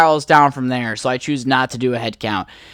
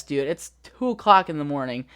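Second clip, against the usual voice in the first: ordinary delivery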